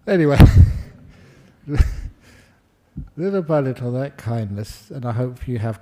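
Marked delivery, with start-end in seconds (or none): none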